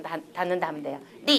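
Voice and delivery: ordinary talk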